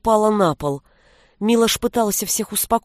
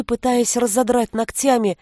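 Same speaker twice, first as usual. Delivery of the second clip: ordinary delivery, nothing marked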